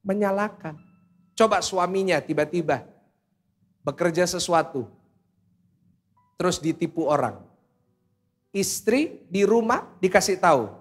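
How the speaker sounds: ordinary talk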